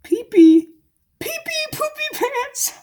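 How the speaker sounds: put-on very high squeaky baby-talk voice; silly squeal. Goofing on the character's name, high silly squeal.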